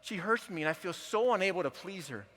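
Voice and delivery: husband crying out his hurt, loud and raw; anguished plea. Retold or imitated. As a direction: let the husband's pain burst out loud. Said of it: "he is crying and choking on his words".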